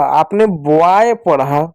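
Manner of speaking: बॉय read out very slowly. Shown as a demonstration. Teacher to children, reading the word slowly. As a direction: draw the word out for the class. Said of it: "अब इसको पूरे को आपने क्या पढ़ा".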